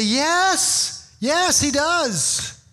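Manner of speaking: high, drawn-out emphatic yes; heartfelt affirmation. Acted. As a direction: affirm it with rising passion, stretching the words. Emphatic longed-for answer to friends.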